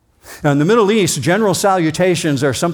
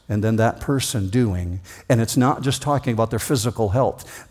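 ordinary talk

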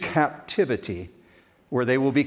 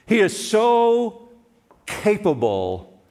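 emphatic; 8 semitones higher, 4 dB louder, slower than usual. Acted. Preaching emphasis.

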